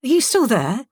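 woozy, searching voice; groggy. A narrator's reading. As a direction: ask as if struggling to come round.

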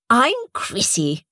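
slow, welcoming hostess; a hint of a sweet hostess; Chrissy's voice. A narrator's reading.

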